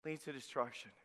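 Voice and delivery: soft, low, solemn repeat; grave quiet repeat. Shown as a demonstration. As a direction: let it sink in softly. Repeated warning phrase, dropped.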